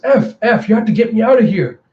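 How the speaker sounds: high, loud, hurried cry for help; acted panicked shout, rushed at the end; urgent plea. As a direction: beg frantically to be let out. Caged prisoner begs for release.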